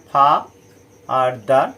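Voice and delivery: ফা and দার as separate stressed beats; split into syllables. Shown as a demonstration. Teacher recapping two syllables, ফা and দার said apart. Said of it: "দুবার উচ্চারণ করছি".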